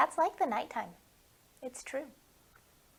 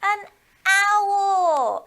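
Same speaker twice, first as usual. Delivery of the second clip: excited reveal; 3 semitones higher, 10 dB louder than usual. Acted. Revealing riddle answer to kids.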